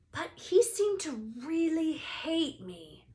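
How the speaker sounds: put-on young Harry, lightly hurt; Harry worried. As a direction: plead it, hurt.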